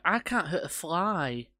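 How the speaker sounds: high-pitched, put-on British accent; mock British accent. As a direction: imitate a film character's not-so-good British voice. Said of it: "Why the hell does it have a British accent".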